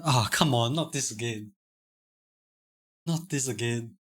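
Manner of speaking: put-on muttered, fed-up groan of a kid being scolded; exasperated muttering. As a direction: grumble it under your breath, worn out. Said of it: "No, as in".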